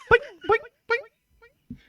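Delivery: high slow boings; cartoon boing noises. Mario jumping, slow high boings. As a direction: bounce the voice like jumping. Jump sounds.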